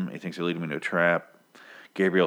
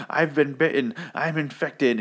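higher-pitched, imitated outburst; mock frantic reveal; 7 semitones higher than usual. Bitten man revealing infection to cannibals, mock frantic. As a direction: announce it like a crazed victim, pitched up.

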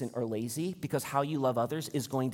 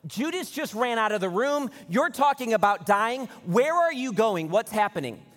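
high, loud, agitated questions; panicked freak-out; 7 semitones higher, 4 dB louder than usual. Acted. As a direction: freak out with pitch way up, in mock panic. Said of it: "starting to panic and freak out".

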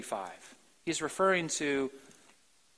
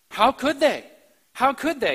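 emphatic; 6 semitones higher, 5 dB louder than usual. Real, not acted. Preacher's rhetorical outburst.

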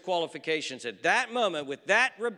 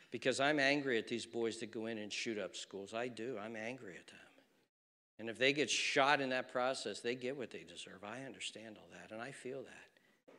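soft, low-voiced anger; quiet intensity; 7 semitones lower, 9 dB quieter than usual. Real, not acted. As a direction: hold the anger down to a murmur. Sermon drops very soft.

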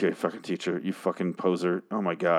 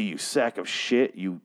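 ordinary talk, nothing marked

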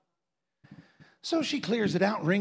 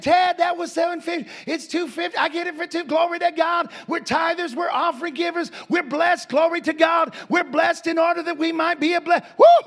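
high, raised voice, rejoicing; excited praise; 8 semitones higher, 4 dB louder than usual. Retold or imitated. Preacher tells friend about the bargain, excited shouting. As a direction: tell Ted the good news exultantly. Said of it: "I said".

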